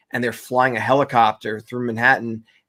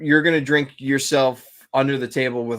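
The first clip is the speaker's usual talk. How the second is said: usual speaking voice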